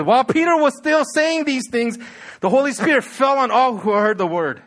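scripture read out loud, high and forceful; impassioned pulpit reading; preach. Acted. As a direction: proclaim the climax with rising fervor.

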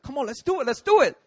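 high goading, building to loud; mock-aggressive comeback, ending in a shout; fight challenge. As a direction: imitate a bouncer squaring up. Bouncer answers the challenge.

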